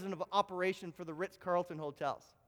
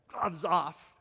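usual speaking voice

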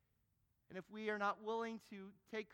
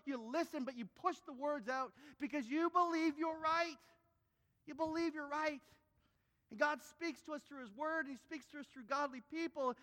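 high, loud preaching at full force; fiery preaching; 9 semitones higher, 6 dB louder than usual. Acted. Preacher rebuking the arrogant congregation, fiery and loud. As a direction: drive the point home to the congregation.

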